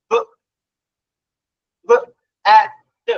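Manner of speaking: isolated short sounds, "B" as "buh", "T" as "tuh"; sounding out. Shown as a demonstration. Teacher decoding bat for parents, sounding out "B, A, T". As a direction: sound "B, A, T" with pauses, never letter names. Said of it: "শেখাচ্ছি ফনিক্স এর নিয়ম".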